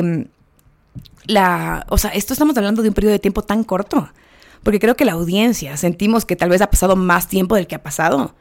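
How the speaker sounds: ordinary delivery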